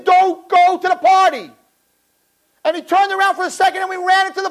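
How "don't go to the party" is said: louder, higher slow warning yell; slow pleading shout of warning; mock-urgent warning cry; Mordechai screaming. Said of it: "Mordechai was screaming".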